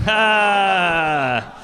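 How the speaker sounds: long, loud drawn-out "ah"; hammed-up groan of release; mock climax groan. Husband admits to wife he already went, mock groan.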